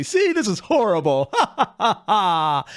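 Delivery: laughing through a fake-cheerful line, then a short laugh; a bit of a laugh, as if it were all a joke; laughing mock quote. Mocking the expected joke tone.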